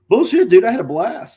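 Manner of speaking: high, loud mock outrage; retold loud protest. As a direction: burst out in disbelief, as if retelling. Retold rebuttal to an apology.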